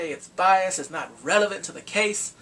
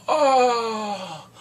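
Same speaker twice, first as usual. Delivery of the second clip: shocked gasp; 6 semitones higher, 8 dB louder than usual. Retold or imitated. Everyone gasps in shock.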